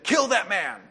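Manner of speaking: a touch of Jezebel's angry command; understated retold order to kill; mild angry order. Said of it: "She was angry".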